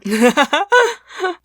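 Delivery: high burst of laughter; amused laugh. Real, not acted. Podcaster amused by co-host's Naruto remark, high laugh.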